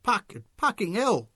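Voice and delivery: loud, high, slow scattering shouts. A narrator's reading. Supporters scattering from the whirling cue, shouting. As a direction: yell as you scatter. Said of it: "shouts of".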